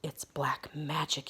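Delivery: slow hushed whisper; shriek. Acted. Archer horrified at the hat, slow whispered dread. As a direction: breathe it out in terror. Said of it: "he shrieked".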